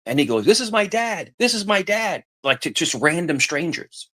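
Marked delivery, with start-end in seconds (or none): 0.5-2.2 put-on high, eager kid voice; imitating excited boy. Retelling the boy showing off his dad, high kid voice. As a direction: pipe up like a proud little boy.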